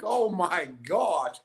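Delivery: retold amazed reaction, stretched out; mock astonishment. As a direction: draw it out, lightly stunned. Retells his shock at mafia friends.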